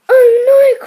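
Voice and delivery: high, very loud wail of protest; horrified cry. A narrator's reading. William refusing slug burgers, horrified cry. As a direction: wail it out in horror. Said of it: "cried William".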